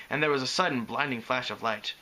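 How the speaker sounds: ordinary delivery, nothing marked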